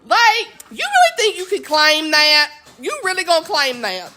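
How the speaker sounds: sassy refusal. Acted. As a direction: shut him down with a laughing brush-off. Scolding the character, laughing.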